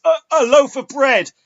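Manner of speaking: stall, then blurt it out; hesitant mock Lorne. Retelling Lorne's punchline, high halting mock whine. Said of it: "sort of pauses and goes".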